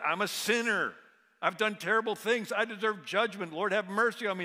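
pleading sinner. Retold or imitated. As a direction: cry out for mercy like a repentant sinner.

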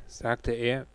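ordinary delivery

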